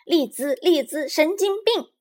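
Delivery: high, loud taunting chant; rude retort. A narrator's reading. Little Bear hurls an insult back at Lizi, rudely. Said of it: "毫不客气地回敬她".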